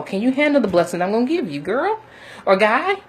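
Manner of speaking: usual speaking voice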